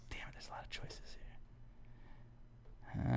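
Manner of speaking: unvoiced muttered aside; whispering. Real, not acted. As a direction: whisper it to yourself. Muttering to himself while choosing.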